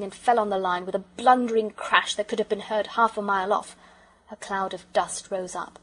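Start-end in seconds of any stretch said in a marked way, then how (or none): none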